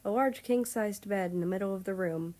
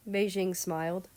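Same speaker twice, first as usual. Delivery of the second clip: ordinary delivery, nothing marked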